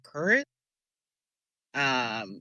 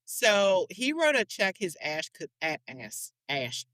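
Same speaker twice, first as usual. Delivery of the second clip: ordinary talk, nothing marked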